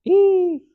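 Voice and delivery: high, loud squeal at bitter coffee; disgusted squeal. Real, not acted. Tasting bitter coffee, a loud high squeal of disgust. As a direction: let out a sharp yelp of disgust.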